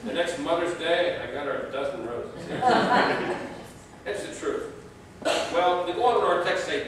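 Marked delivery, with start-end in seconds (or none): 2.5-4.0 laugh. Real, not acted. Laughing at own joke.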